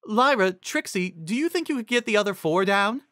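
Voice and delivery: high pony voice; Cheerilee's voice. A narrator's reading. Narrator voicing Cheerilee asking the unicorns for help, high.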